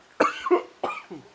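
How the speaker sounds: harsh hacking cough; coughing fit. Real, not acted. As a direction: cough hard, out of control. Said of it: "went down the wrong pipe".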